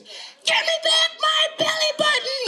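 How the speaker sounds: put-on very high, drawn-out child's yell; high-pitched cartoon boy scream; screaming little boy. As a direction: squeal the demand slowly as an angry kid. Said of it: "what are you screaming for".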